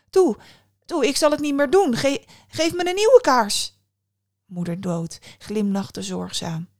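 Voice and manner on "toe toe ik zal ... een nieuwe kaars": loud, high, fast begging that stumbles; panicked pleading. A narrator's reading. Boy begs his godmother for a new candle, panicked. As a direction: beg for your life, voice shooting up. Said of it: "Die jongen keek verschrikt".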